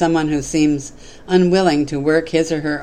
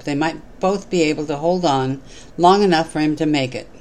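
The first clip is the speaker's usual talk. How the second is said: ordinary delivery, nothing marked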